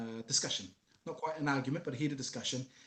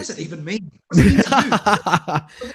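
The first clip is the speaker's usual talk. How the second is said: laughing loud retort; 4 semitones higher, 19 dB louder than usual. Real, not acted. Guest laughing back at the host's compliment, loud. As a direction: throw the question back laughing.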